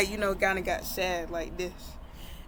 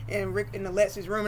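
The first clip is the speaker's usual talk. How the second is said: ordinary delivery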